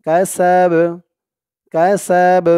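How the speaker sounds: "কাসাব" with a bouncing final b; qalqala. Shown as a demonstration. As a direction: recite "কাসাব", pushing the last b out with a small echo. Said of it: "একটু ধাক্কা দিয়ে পড়তে হবে". ("কাসাব"-এর বা-তে ধাক্কা দিয়ে কলকলা)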